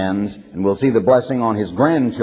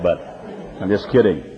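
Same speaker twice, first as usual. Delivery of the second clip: ordinary talk, nothing marked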